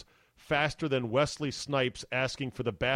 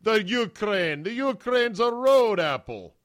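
slow, higher, louder sitcom quote; Kramer; 8 semitones higher, 5 dB louder, slower than usual. Retold or imitated. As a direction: ham up the line like Kramer. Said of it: "that Kramer said".

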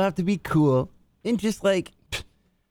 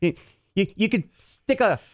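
hammed-up put-on voice; 7 semitones higher than usual. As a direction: ham it up in a stretched, lifted voice. Answers impression with own voice.

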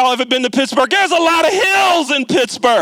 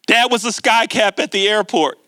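normal talking voice

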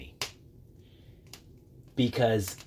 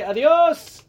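much higher, louder adios; hammed-up, loud farewell; called-out goodbye; 11 dB louder than usual. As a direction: shout it across the street as you pass. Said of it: "you just say".

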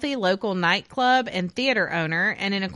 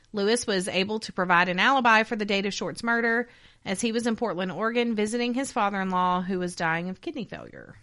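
ordinary talk

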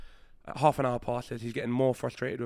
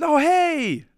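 much higher and louder startled hey; surprised kid greeting; 12 dB louder than usual. Retold or imitated. The kid noticing him beside him, a high, loud surprised hey. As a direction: squeak it out like a kid caught off guard.

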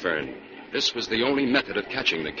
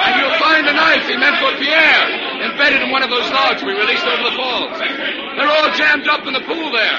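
loud, higher explanation called over the roar; calling out; 14 semitones higher, 6 dB louder than usual. Acted. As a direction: keep projecting over the water. Explaining loudly over the falls.

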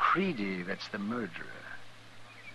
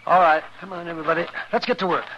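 louder, higher rallying call; 6 semitones higher, 4 dB louder, faster than usual. Acted. Doctor rousing the townsfolk to get working, calling out loud. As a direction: call the whole room to action.

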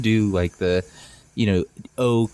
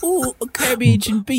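high-pitched caricature Irish voice; Irish accent; 12 semitones higher than usual. Shown as a demonstration. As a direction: ham up a badly faked Irish accent, pitched way up. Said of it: "Let's hear an Irish accent".

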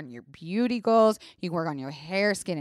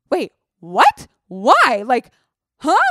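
loud, high, bewildered bursts; retold shock; 4 semitones higher, 10 dB louder, slower than usual. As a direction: blurt it out in stunned disbelief. Reacting to the panicked advice.

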